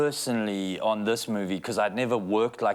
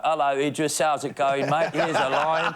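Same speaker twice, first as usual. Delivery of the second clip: hammed-up English mate, pitched up; mock English accent; 6 semitones higher, 3 dB louder, slower than usual. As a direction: put on a jokey, exaggerated English accent. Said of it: "as an English".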